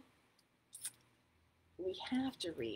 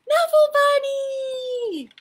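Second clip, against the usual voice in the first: high, loud, stretched-out squeal of words; ecstatic toddler cry; 13 semitones higher, 10 dB louder, much slower than usual. Acted. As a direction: cry out joyfully on finding your toy. Trixie spots her lost bunny.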